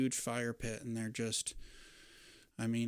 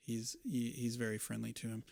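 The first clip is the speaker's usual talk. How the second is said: ordinary talk, nothing marked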